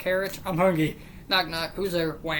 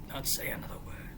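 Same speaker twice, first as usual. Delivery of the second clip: whispering; 11 dB quieter, faster than usual. Acted. Punchline whispered fast.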